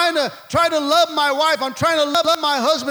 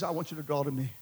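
much lower and softer, a tender God; God's gentle voice; 11 semitones lower, 12 dB quieter, much faster than usual. Acted. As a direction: speak as God, low and hushed to his child. God answering, voiced by preacher.

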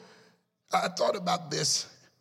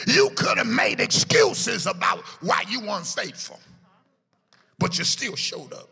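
shouted preaching; 3 semitones higher, 5 dB louder than usual. Acted. Preaching at full force.

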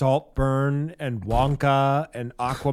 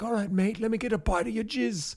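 much higher, hammed-up British accent; Jacob Elordi impression; 11 semitones higher than usual. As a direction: play a cheeky Brit, pitched way up. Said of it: "Jacob E. Lordy was like".

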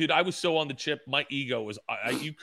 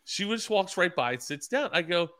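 ordinary talk

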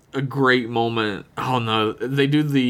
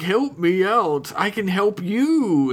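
high, slow pleading voice; pleading alien; 8 semitones higher, slower than usual. Retold or imitated. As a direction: plead to be let out. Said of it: "he's like".

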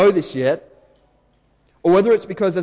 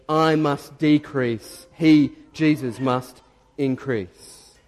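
slow solemn; much slower than usual. Acted. Quoting John's famous humble words.

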